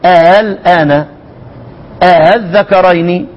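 الآن drawn out slowly with a gentle hamza; eased hamza, slow. Shown as a demonstration. Teacher shows how the reading must sound. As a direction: recite الآن الذكرين slowly, easing the hamza. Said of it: "لازم تقرأ لي كده".

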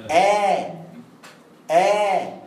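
"آ" as a long steady aa after hamza; alif of madd. Shown as a demonstration. Teacher showing where alif comes from, "آ" held long. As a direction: hold "آ" evenly. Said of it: "مخرج الألف".

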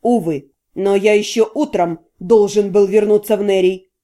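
low, cool formal refusal; cool and polite. A narrator's reading. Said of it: "прохладно проговорил Вашарий".

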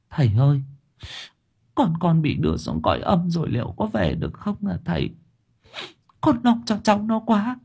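put-on mother frets for her dead child, anxious and high; worried mother. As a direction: plead with the master, voice lifting with worry. Worried mother asks the exorcist.

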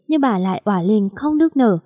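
plain reading voice

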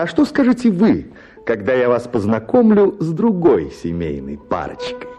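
usual speaking voice

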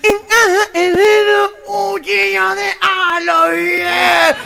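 high, drawn-out nasal call; imitated gangoso leader; gangoso proverb. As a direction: cue the crowd with a garbled saying. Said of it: "voy a decir un dicho".

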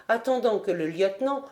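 plain reading voice

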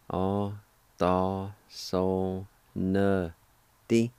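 "A ta tle ti" split into separate syllables; slow syllable-by-syllable teaching demo. Shown as a demonstration. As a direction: pause after each syllable of "A ta tle ti". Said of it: "it's pronounced like this".

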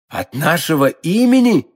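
a touch of astonished questioning; mildly taken aback; surprised exclamation. A narrator's reading. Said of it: "хором воскликнули три мушкетёра".

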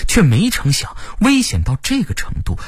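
ordinary delivery, nothing marked

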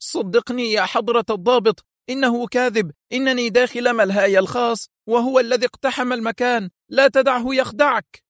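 nervous pleading. A narrator's reading. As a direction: beg the officer to believe him. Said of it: "لوح رشاد بذراعيه في عصبية وهو يصيح".

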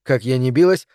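plain reading voice

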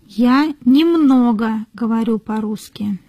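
ordinary talk, nothing marked

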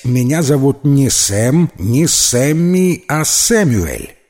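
slow and deliberate, weight on Сэм, Сэмми, Сэмюэль; emphatic correction. A narrator's reading. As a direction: spell out the name, drawing out Сэмми and Сэмюэль. Correcting his friend on his name.

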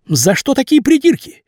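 high, aggrieved pleading; pleading young man. A narrator's reading. Vasya argues with boss.

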